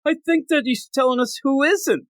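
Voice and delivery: high put-on voice of a scientist; comic squeaky mock scientist; guy's line. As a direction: pitch way up for the baffled guy. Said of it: "the guy's like".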